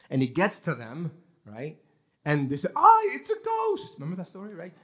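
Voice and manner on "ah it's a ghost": high, slow mock scream of terror; frightened cry. Disciples in the boat cry out in mock terror. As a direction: shriek like you saw a ghost. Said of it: "they're freaking out".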